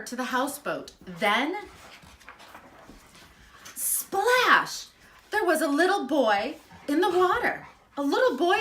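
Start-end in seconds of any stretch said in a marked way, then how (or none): 3.7-4.9 high, loud splash; big splash. A narrator's reading. The boy jumps into the river, loud splash. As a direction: shout the splash like a big jump in.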